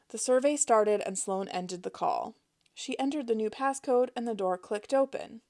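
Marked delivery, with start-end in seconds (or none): none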